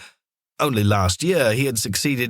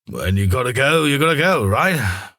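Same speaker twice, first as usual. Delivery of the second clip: a hint of an embarrassed dad, a little louder; sheepish dad; 3 semitones higher, 3 dB louder than usual. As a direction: shrug it off with an awkward chuckle. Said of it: "he said sheepishly".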